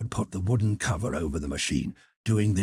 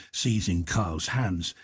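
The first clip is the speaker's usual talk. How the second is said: plain reading voice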